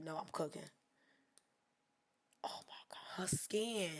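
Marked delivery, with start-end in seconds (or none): 2.4-3.9 hushed, unvoiced whisper; whispered admiration of her skin; awed whisper. Real, not acted. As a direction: breathe it out in wonder. Awed by the photo.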